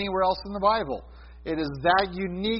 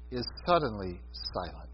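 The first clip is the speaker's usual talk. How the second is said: hushed; 8 semitones lower, 9 dB quieter than usual. Real, not acted. Preacher stresses heaven's silence.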